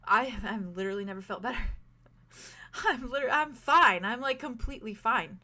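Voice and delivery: giddy, breathless joy; elated. Acted. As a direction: laugh through the relief. Silas tells Whit he's cured.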